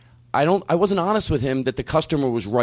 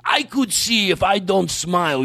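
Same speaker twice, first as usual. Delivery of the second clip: slow, drawn-out indignant mimicry; imitating his voice; 4 semitones higher, slower than usual. Comedian voicing the man's rant at a customer, slow indignant imitation. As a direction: mimic the aggrieved man, stretching words, curses rising higher. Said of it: "do him doing that voice".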